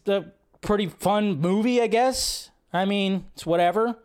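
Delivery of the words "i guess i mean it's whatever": usual speaking voice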